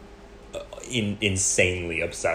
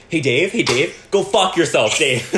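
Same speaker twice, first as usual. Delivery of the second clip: loud, higher chanted insult; mock shouting; 6 semitones higher, 7 dB louder than usual. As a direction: yell it like the family catchphrase. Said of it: "me and my mom are, like, always like".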